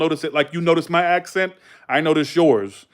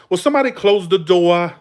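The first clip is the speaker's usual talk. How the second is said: higher mock New England accent; dropped-R Northern call; Massachusetts accent; 4 semitones higher than usual. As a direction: imitate a shop worker's thick Massachusetts accent. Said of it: "What kind of fucking accent".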